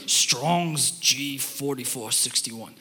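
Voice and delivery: low, hushed, slow dictionary voice saying "Rabbi"; deep-voiced audio narrator. Mimicking the online dictionary's deep-voiced audio for "Rabbi", low and hushed. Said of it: "really deep voice dude will say it".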